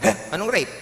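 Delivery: loud, high protest; indignant hold-upper. Acted. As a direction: react like an affronted robber in a joke. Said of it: "nag-react yung hold-upper".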